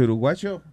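"uruguayo" with a hard sh or ch for the y; Argentine pronunciation. Shown as a demonstration. Host asking if Argentines say it alike, "uruguayo" with sh-like y. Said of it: "el argentino dice".

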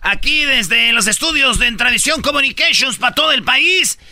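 loud, high radio host; radio announcer. Acted. Radio host opening the show.

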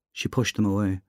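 usual speaking voice

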